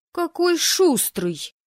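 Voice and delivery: high, loud, drawn-out indignation; loud, scornful exclamation; angry outburst. Acted. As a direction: fire back in an indignant high voice. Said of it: "гневно выпалила я".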